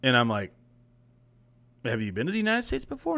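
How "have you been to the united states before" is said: quick, high, airy question; imitating himself asking lightly; mock high quoting voice. Mock question to Australian guest.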